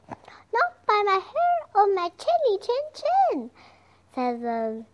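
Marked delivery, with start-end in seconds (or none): 0.1-3.5 very high, squeaky pig refusal; little pigs voice. Acted. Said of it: "answered the little pigs".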